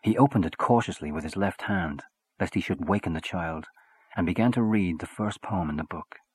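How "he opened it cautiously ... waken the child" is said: plain reading voice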